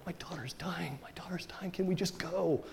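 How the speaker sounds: urgent high whisper; anxious whisper. Retold or imitated. Father begging Jesus to hurry, in an urgent whisper. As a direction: hurry him along in a frantic whisper.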